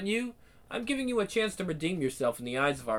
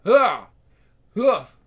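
fighting grunts; 3 semitones higher, 8 dB louder than usual. Acted. Fight blows.

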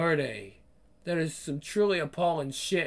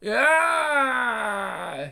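sharp yelp of pain; short scream of pain; pained cry; 7 semitones higher, 4 dB louder than usual. Acted. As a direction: cry out as you are hit. Hurt in the fight.

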